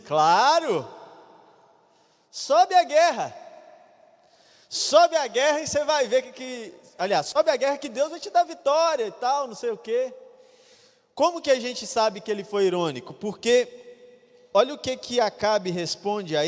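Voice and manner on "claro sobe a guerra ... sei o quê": mockingly eager, higher and louder; sarcastic go-ahead, pitched high like fake cheer; exaggerated mock encouragement to the king; ironic. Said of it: "parece falar num tom de ironia assim".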